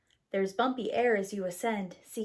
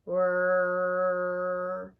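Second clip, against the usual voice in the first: louder, droning mechanical whirr; engine whirr; 2 semitones lower, 5 dB louder than usual. Pretend. Engines start one by one, droning whirr. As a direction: hum it like a spinning engine.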